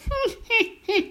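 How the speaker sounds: high thin cackling snicker; witch snicker. Acted. Witch sniggering at the teller's excuse. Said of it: "Elle ricane doucement".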